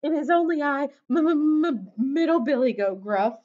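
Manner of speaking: high, loud, stammering goat; frightened high-pitched stammer; shaking with fear. A narrator's reading. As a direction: stutter your name to the troll in fright. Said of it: "shook so hard he almost fell down".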